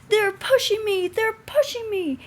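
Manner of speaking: high, loud frightened panting cry; panting in fright. A narrator's reading. Runaway James panics as trucks push him. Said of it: "he panted".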